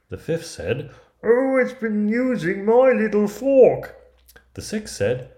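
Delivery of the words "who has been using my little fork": very high, loud, slow dwarf cry; put-out storybook dwarf at the top of the range; indignant dwarf. Acted. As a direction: draw it out in a squeaky, loud dwarf voice. Fifth dwarf finds his fork used.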